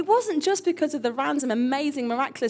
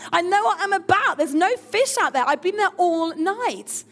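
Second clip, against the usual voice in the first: groan, then loud, higher-pitched protest; exasperated fisherman, hammed up and emphatic; indignant fisherman; 5 semitones higher, 4 dB louder than usual. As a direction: play an affronted old hand telling off a landlubber. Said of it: "And Simon says".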